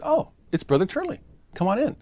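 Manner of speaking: high, bright-pitched welcome from the wife; cheery wife greeting. Retold or imitated. Wife welcoming Brother Turley at the door, in a high cheery voice. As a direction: sing out the welcome like a happy hostess.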